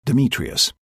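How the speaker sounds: ordinary delivery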